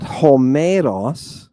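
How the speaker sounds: "Homeros" with the middle syllable "me" stressed and drawn out; slow "Homeros", accent moved to the second syllable; misplaced accent. Shown as a demonstration. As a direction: wrongly lean on "me" in "Homeros", very slowly. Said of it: "but Homeros".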